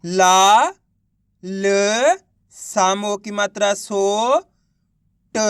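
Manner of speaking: ला, ल, सो, ट held long, one at a time; spelling out syllables. Shown as a demonstration. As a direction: sound out ला, ल, सो, ट with clear gaps. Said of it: "एक एक अक्षर का उच्चारण". (ला, ल, सो, ट को अलग-अलग खींचकर बोलना)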